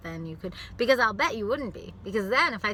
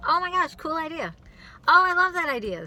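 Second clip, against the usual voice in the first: high, bright people-pleaser gush; mock eager agreeing; overly agreeable; 9 semitones higher, 7 dB louder than usual. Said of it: "Just like".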